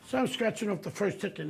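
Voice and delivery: ordinary talk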